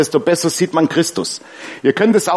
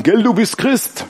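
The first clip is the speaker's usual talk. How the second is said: slow, higher voice for the satanist patient; satanist neighbour; 6 semitones higher, slower than usual. Retold or imitated. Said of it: "da sagt er nebendran".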